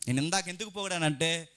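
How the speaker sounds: ordinary talk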